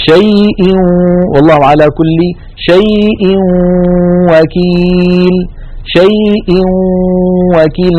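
شيء وكيل chanted as "shay-iw-wakeel" with a long nasal waw; slow Quranic recitation, وكيل held and nasal; tanween into waw with ghunna. Shown as a demonstration. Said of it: "ننتقل من همزة شيء إلى واو وكيل مع بقاء الغنة".

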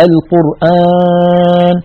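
القرآن stretched slowly with the alif forced through the nose; nasal alif demo. Shown as a demonstration. Teacher imitating students' mistake: nasal madd. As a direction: drag out القرآن nasally, as a faulty reciter would. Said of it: "فيضغط على أنفه".

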